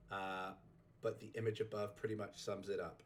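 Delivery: ordinary delivery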